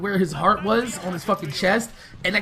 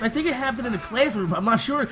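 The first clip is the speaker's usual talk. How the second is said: ordinary delivery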